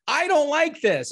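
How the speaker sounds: high, loud, drawn-out protest; exaggerated frustration, stretch it out and push it up; mock angry outburst. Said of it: "and I'm pissed".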